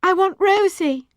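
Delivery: put-on high, loud child's wail; distressed, lost-child cry; nearly crying. A narrator's reading. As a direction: plead on the edge of tears. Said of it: "I said, nearly crying".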